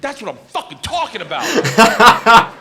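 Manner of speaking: loud, high, stretched-out cheer; exultant shout. Retold or imitated. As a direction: build to a yell of triumph. Cheers his first-class upgrade.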